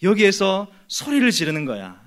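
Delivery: hushing someone with acted outrage; acted scolding. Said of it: "이런 말투로".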